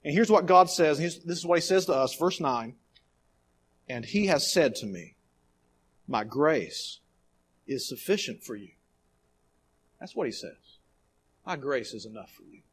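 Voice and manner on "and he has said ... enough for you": hushed, gentle reading of God's reply; soft and tender. Acted. Preacher to the hurting, softly voicing God's promise. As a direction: let it fall soft, like comfort.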